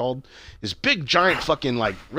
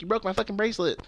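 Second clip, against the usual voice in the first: retold anger; 8 semitones higher, faster than usual. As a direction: imitate a mad sister, lightly. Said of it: "then she got all mad".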